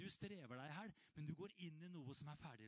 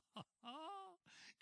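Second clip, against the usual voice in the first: a high, breathless laugh of joy; high joyful laugh; 12 semitones higher, 3 dB quieter than usual. Real, not acted. Preacher laughing with joy at his own sentence, high and light. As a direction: laugh out of sheer delight.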